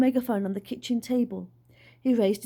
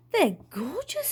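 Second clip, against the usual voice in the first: admiring; 7 semitones higher than usual. Acted. Dad admiring the wings.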